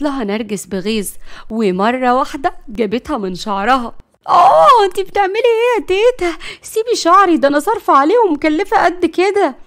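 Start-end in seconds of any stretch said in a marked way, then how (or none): 4.3-9.5 high, loud shrieking protest; pained yelling. Acted. Layla protests as grandmother pulls her hair, yelping. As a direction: cry out as your hair is yanked.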